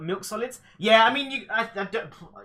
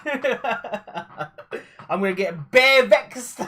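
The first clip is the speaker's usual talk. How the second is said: laughing London roadman slang; london roadman accent; 2 semitones higher, 4 dB louder than usual. Pretend. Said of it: "turn full on London".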